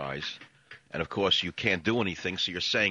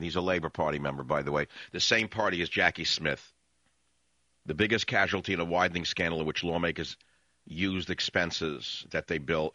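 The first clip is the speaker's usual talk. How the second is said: ordinary talk, nothing marked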